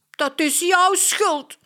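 high, loud, angry blame with jouw hit hard; angry shout. Acted. Mia blames Katja for the stain, angry shout. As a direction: yell it at her, furious. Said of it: "roept Mia boos".

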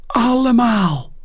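hoog en luider, blij roepen; blije uitroep. Acted. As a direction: roep het vol enthousiasme. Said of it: "roept Max". (jonge fan roept blij ja tegen de schrijfster)

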